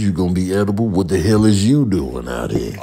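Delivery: usual speaking voice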